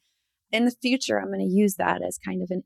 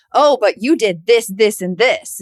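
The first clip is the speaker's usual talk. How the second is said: louder, counterattacking friend; defensive comeback; 8 dB louder than usual. Retold or imitated. A friend hitting back with blame, loud and defensive. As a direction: fire back a list of blame. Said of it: "come back and be like".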